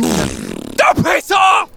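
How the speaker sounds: shouted loud and high; angry outburst. Acted. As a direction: suddenly yell it in anger. Storyteller snaps at heckler.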